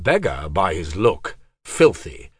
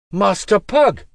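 surprised call; 11 semitones higher, 6 dB louder than usual. Acted. Student calls out in surprise.

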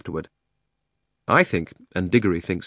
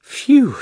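a long breath of relief, high and loud; relieved phew; 8 dB louder than usual. A narrator's reading. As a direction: blow it out in relief. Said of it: "letting out a long breath of relief".

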